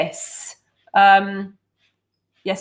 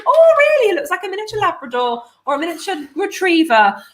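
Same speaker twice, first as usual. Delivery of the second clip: surprised passerby; 7 semitones higher, faster than usual. Retold or imitated. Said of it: "they go".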